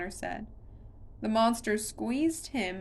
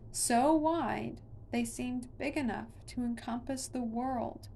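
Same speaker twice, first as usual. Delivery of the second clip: usual speaking voice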